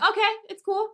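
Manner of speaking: squeaky high, imitating herself; high mock okay. Letting the cutting guy pass, high mock voice. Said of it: "I was like".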